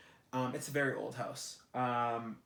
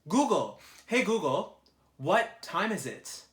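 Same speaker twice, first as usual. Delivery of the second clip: higher, louder and slower, calling at the phone; louder repeat; 6 semitones higher, 8 dB louder, slower than usual. Real, not acted. Repeating the question to the phone assistant, loud and slow. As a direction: call out so the phone finally hears. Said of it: "repeats louder to phone assistant".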